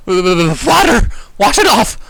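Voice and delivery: high, loud desperate yelp; panicked yelp. Acted. Narrator begging friends to wash off the burning gel, loud yelp. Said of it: "I managed to yelp".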